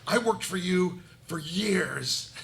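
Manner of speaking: hammed-up drawn-out outrage; indignant complaint. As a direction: rail at your old master, drawing out each phrase. Tower man berates former master.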